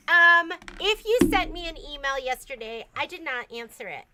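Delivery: ordinary talk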